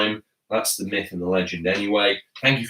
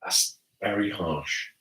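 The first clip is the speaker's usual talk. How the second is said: ordinary talk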